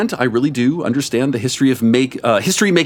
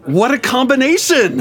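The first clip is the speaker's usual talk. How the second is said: high and louder, laughing through it; 14 semitones higher, 3 dB louder than usual. Acted. Kirk amused by the DNA combination, laughing high. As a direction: blurt it out, still laughing. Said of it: "I say aloud with a laugh".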